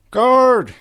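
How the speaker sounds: a high, loud call across the room. Acted. Cadance calls the guard into the room, loud high call. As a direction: summon the guard with a bright shout. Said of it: "Cadance called".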